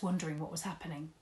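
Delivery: plain reading voice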